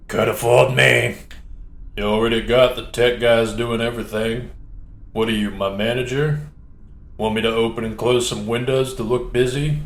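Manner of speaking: lazy, sarcastic crew member voice; a lightly voiced slacker, mocking the boss; bored crewman.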